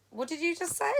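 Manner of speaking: put-on confronting a kid, voice rising high; stern challenge. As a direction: call him out, sharp and high. Confronting boy on the tram.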